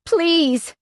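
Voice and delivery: high, desperate plea; begging. A narrator's reading. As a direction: cry out, begging. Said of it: "I beg".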